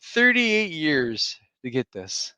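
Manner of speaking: high, slow, whiny mock complaint; mock lament. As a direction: whine it like a wronged fan. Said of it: "I was like".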